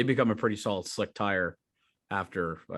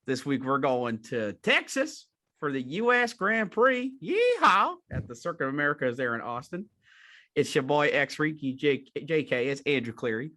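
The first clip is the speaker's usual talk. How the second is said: put-on Southern accent; 5 semitones higher, 5 dB louder than usual. Andrew mock-introduces himself as Ricci in Southern accent. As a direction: play a Texas host with a big Southern accent. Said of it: "sporting the nice Southern accent".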